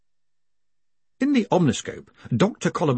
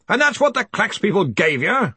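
higher, forceful questioning from the boss; stern, pressing interrogation; demanding; 9 semitones higher than usual. A narrator's reading. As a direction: demand an answer from a subordinate. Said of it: "the Arch-Chancellor demanded".

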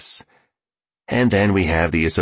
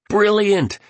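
high-pitched burst of enthusiasm; enthusiastic, sharply raised pitch; enthused; 13 semitones higher, 3 dB louder than usual. A narrator's reading. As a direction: exclaim it high, with delight. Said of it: "enthused the billionaire".

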